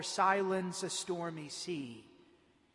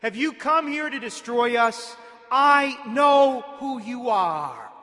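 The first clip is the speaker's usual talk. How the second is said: raised, forceful voice of the possessed man; crying out; 3 semitones higher, 9 dB louder than usual. A narrator's reading. Unclean spirit challenging Jesus in the synagogue, crying out. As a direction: cry it out loud, stretching the accusation. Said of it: "He cried out".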